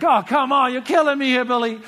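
high, loud, comic exasperation; exasperated protest. Retold or imitated. Student to teacher delaying the secret till after coffee, exasperated protest. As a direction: cry out at being kept in suspense.

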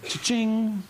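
high, sing-out till sound; cash-register imitation. Cashing in a ticket to heaven, cash-register imitation.

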